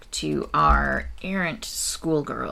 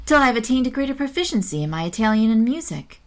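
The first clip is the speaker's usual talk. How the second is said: Charlotte voiced; 7 semitones higher, 4 dB louder than usual.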